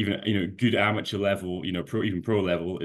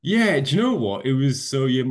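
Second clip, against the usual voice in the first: much higher and louder, bursting to answer; eager burst; 7 semitones higher, 6 dB louder than usual. Real, not acted. Athlete asked about his first win, answers with an eager high burst. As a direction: jump in keen and lifted, the voice up high. Asked how winning felt.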